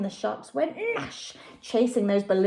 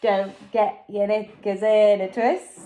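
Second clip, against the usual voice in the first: put-on lower, unbothered boy's voice; calm Jack; 4 semitones lower than usual. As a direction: brush off the panic coolly. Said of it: "said Jack".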